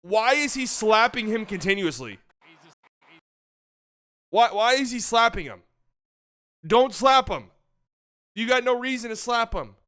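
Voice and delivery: angry fan venting; exasperated anger. Real, not acted. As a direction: keep venting at the slapping. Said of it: "had me actually angry".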